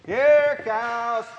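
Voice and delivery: calling the cows. Retold or imitated. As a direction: call the herd in, ringing out.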